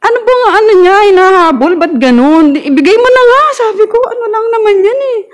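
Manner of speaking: indignantly firing questions back at her; retold own anger. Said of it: "galit na galit na rin ako".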